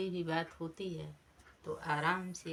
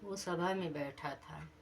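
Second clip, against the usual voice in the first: usual speaking voice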